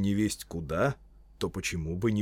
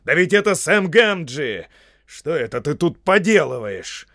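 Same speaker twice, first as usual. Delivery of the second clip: loud, raised, astonished demand; exclaiming wizard; 6 semitones higher, 10 dB louder than usual. Acted. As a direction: call out a culprit caught in the act. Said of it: "вскричал Гэндальф".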